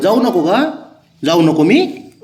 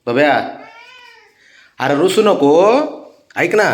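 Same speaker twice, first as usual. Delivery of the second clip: higher, sing-song coaxing of a sulking pet; 8 semitones higher, 7 dB louder than usual. Real, not acted. Owner coaxing his sulking cat Babya before work, sing-song coaxing. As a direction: sweet-talk the cat so it lets you leave.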